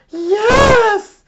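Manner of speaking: very high, loud cartoon dinosaur mom; Mama Dinosaur. Mama Dinosaur agreeing with Papa, shrill high cartoon voice. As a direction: squeal it like a monstrous mama.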